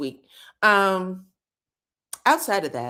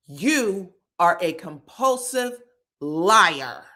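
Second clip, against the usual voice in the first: deliberate accusation; 2 semitones lower, much slower than usual. Retold or imitated. Recapper playing Ken condemning Trisha, slow and deliberate. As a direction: lay down the verdict piece by piece. Ken's verdict on Trisha, acted out.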